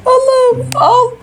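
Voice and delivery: high mock sob while running; mock crying dog. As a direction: whine and sob like an exhausted pet. Dog cries while forced to run.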